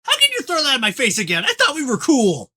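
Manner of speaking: high, loud indignant outburst; indignant shout. Acted. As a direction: yell it back, offended. Troll character arguing back.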